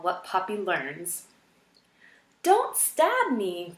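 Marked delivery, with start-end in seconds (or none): 2.4-3.7 high, slow, pleading squeak; mouse crying in terror. A narrator's reading. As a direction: beg for your life, teeth chattering. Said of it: "Poppy cried through chattering teeth".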